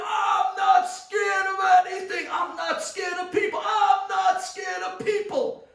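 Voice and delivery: high, loud scream. Retold or imitated. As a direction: scream it from the top of your lungs. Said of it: "the kid screamed".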